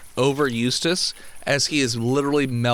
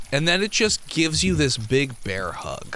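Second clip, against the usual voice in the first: ordinary talk, nothing marked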